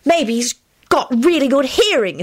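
lightly voiced, high girl; girl calling out. A narrator's reading. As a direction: pipe up tauntingly. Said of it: "skinny girl with blonde pigtails called out".

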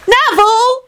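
very high kiddie call; child's shout. A narrator's reading. Teddy calls Neville out, shouting. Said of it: "Teddy shouted".

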